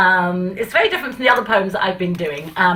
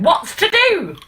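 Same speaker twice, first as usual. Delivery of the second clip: manager asking; 10 semitones higher, slower than usual. Acted. Manager voiced in poem.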